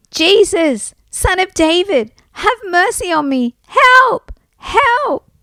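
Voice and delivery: high, desperate cry for help; shouting out. A narrator's reading. Blind Bart calls to Jesus over the crowd, shouting. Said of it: "he shouted out as loudly as he could".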